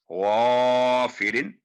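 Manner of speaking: gargle out the गा of गाफिरिन, full and long; heavy ghain. Shown as a demonstration. Teacher demonstrates the heavy ghain in गाफिरिन. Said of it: "ये गाफिरिन नहीं होगा".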